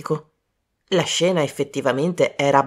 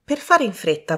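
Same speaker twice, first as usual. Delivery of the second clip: ordinary talk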